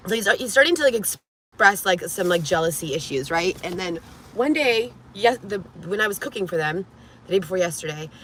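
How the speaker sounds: ordinary talk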